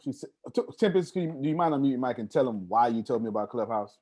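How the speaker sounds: ordinary talk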